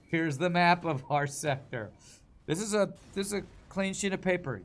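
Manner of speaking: laughing loud through the words. Real, not acted. Joking about blank map.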